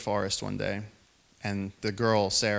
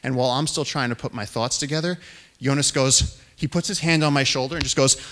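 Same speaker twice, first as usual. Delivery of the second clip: usual speaking voice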